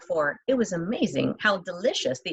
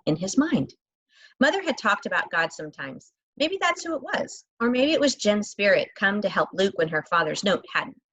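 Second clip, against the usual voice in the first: usual speaking voice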